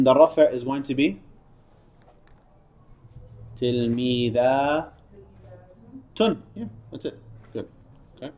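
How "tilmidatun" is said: tilmidatun, slowly, with a long "a" before the tun; elongated alif. Shown as a demonstration. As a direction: stretch the "da" of tilmidatun. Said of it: "You need to elongate the alif".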